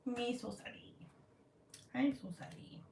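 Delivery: small, soft put-on voice; playful sheepish apology. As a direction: shrink into a tiny mock apology.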